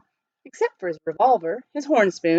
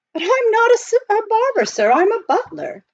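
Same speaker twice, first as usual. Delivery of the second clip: high, precise butler voice; proper butler; 4 dB louder than usual. Acted. Butler explaining to the miner, politely proper. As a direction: speak like a correct English butler.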